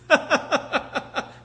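high, loud staccato laugh; hammed-up burst of laughter; imitated mocking laugh. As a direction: laugh in someone's face, put on. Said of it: "he laughed at me".

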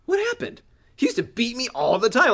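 high, baffled outburst; incredulous. Acted. Younger brother amazed at sudden friendship with bully brother, incredulous. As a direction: squeal it in disbelief. Acts own bewilderment at brother's change.

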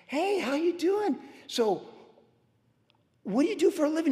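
stretched cheerful greeting. Retold or imitated. Retells greeting the witness.

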